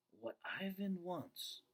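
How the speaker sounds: slow, doubtful echo of her words; dad drawing out her claim in disbelief; slow skeptical repeat. A narrator's reading. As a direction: repeat it slowly, unconvinced. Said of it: "he slowly repeats".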